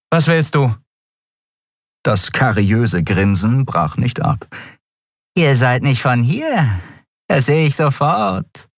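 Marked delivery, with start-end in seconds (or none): 0.1-0.8 hostile. A narrator's reading. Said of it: "Feindselig zog sie die Augenbrauen zusammen". 5.4-8.7 high, sing-song wheedle of a creepy dealer; fluting. A narrator's reading. Dealer sizes up two strangers, high fluting tone. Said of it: "flötete der Mensch".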